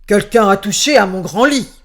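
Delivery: loud booming bear voice; big bear voice. A narrator's reading. Big bear finding his bed touched, loud booming voice. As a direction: boom it like a giant angry bear. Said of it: "regarda son grand lit et dit".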